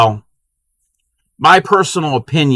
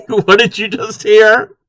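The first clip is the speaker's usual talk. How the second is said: laughing; 9 semitones higher, slower than usual. Real, not acted. Laughing at the squeaky yarn.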